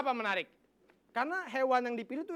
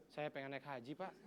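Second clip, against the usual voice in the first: mock sad vendor; 9 semitones lower, 13 dB quieter than usual. Porridge seller acted sadly.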